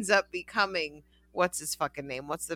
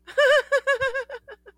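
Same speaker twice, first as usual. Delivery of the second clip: high laugh; 4 dB louder than usual. Real, not acted. Laughing at one shelf.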